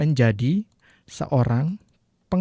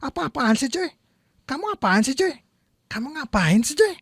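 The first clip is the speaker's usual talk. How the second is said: annoyed casual retort; 10 semitones higher than usual. Shown as a demonstration. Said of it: "terjemahannya kemungkinan".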